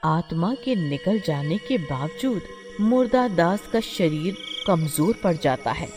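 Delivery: ordinary delivery, nothing marked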